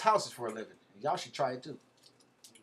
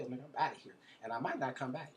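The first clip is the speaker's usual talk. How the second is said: usual speaking voice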